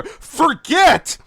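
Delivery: very loud, high scream. Acted. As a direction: scream it like a one-word battle cry. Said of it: "screaming into my ear".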